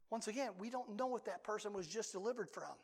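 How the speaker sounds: ordinary talk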